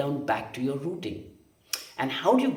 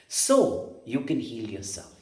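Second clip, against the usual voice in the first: normal talking voice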